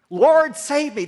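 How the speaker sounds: high, loud plea while sinking; desperate cry. Acted. Sinking Peter begging the Lord, loud desperate cry. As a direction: shout it as the water closes over you. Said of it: "That's all he could think to gurgle out".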